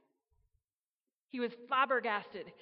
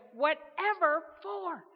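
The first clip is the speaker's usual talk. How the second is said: louder, higher, slow incredulous burst; blurted out; 7 semitones higher, 8 dB louder, slower than usual. Acted. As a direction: blurt it out in disbelief again. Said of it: "once again blurted out".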